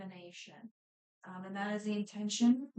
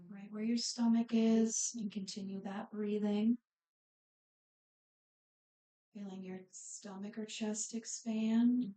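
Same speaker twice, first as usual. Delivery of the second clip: unhurried breathing cues, phrases spaced far apart, softening as it goes; guided meditation. Real, not acted. Yoga instructor settles the class into breathing and body scan, guided meditation.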